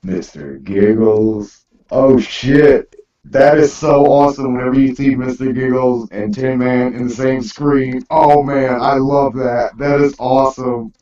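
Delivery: ordinary talk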